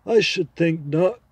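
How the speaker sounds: put-on a confident horse making its case to the boy; talking horse. Horse replies.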